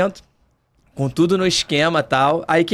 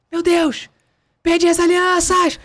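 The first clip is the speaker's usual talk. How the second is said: high, panicked mock cry over lost rings; panicked bride; 11 semitones higher, slower than usual. Bride panicking on the phone to groom, high panicked cry. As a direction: play the bride shrieking in panic.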